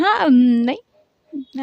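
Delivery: very slow, stretched hasty retreat; slow nervous hedge; drawn-out backpedal. Acted. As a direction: stretch it out as you take it back. Sarika backpedals under glare.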